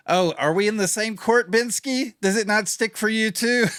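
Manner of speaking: high, bright teasing jab; high mock-incredulous teasing; playful teasing. Real, not acted. Teasing friend about Spanish.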